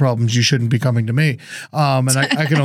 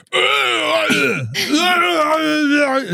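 high, drawn-out wailing animal noise; wordless mock howl, as if talking to bears; imitated bear talk; 13 semitones higher than usual. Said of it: "'cause they can also talk to bears".

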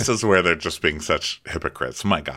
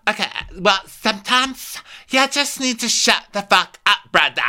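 higher, sassy put-on voice; hammed-up higher voice; 11 semitones higher than usual. [name] voiced in recap.

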